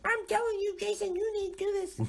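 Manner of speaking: very high mock wife voice; squeaky falsetto nagging wife; high-pitched impression of his insistent wife; imitating wife. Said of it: "a wife that is like".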